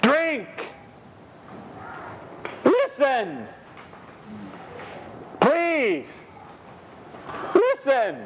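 high, spaced-out pleading words; pleading voice of God. Acted. The Lord pleads with the dry bones, high and pleading. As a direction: beg them gently, word by word.

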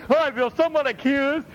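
high, put-on voice of a guilt-ridden saver; mock guilty believer. Preacher imitates a believer feeling accused over savings, fretful and high. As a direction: voice a fretful churchgoer excusing himself. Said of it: "And you're like".

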